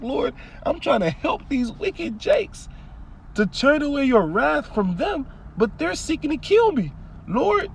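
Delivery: high, slow, strained plea to the Lord; pleading prophet. Retold or imitated. Jeremiah crying to the Lord against the wicked, high and pleading. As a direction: cry out like a betrayed prophet begging God. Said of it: "He's like".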